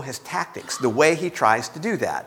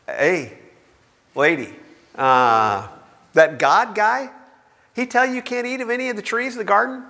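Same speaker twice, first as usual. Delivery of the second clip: tempter's sly voice; 7 semitones higher than usual. Acted. Satan voiced tempting Eve.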